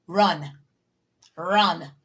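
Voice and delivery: each run barked out loud; forceful warning shout; loud urgent command. Real, not acted. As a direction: tell them to get out, loud. Urgent advice to leave a bad job.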